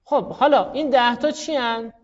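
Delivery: ordinary talk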